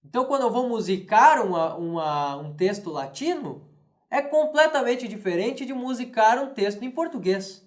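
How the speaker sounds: usual speaking voice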